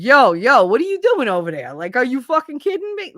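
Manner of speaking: loud, high-swinging Brooklyn challenge; Brooklyn accent. Shown as a demonstration. Demonstrating what Brooklyn sounds like, brash Brooklyn accent. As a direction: play up a confrontational Brooklyn accent. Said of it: "that's Brooklyn".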